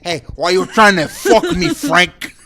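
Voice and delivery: hammed-up French gangster voice; lightly put-on French accent. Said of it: "put a little more French in there".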